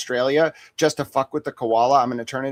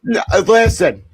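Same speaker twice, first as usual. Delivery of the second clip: laughing insistence; 6 semitones higher, 7 dB louder, slower than usual. Real, not acted.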